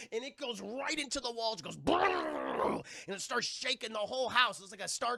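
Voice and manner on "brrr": high, drawn-out rolling "brrr"; cartoonish rattling impact noise; imitated rumbling blast. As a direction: trill out a mock blast noise. Imitates blast hitting the wall.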